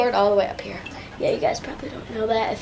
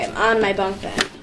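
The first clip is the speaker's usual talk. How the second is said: usual speaking voice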